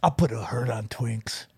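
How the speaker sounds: very low, soft tough-guy voice; manly man. Pretend. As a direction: growl a quiet macho threat, deep. Joining the manly character bit.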